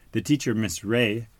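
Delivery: ordinary delivery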